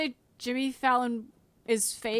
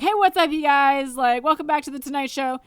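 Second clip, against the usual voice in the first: louder, upbeat talk-show host greeting; hammed-up host welcoming the audience; Jimmy Fallon; 6 dB louder than usual. Horse as talk-show host greets the crowd, hammed-up host voice. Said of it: "that horse walks onto the track and he's like".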